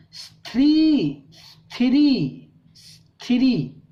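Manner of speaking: स्थिरी sounded out slowly as स्थि-री; slow syllable demo. Shown as a demonstration. Teacher sounds out a tricky word for the class. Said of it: "इसको पढ़ना है स्थिरी".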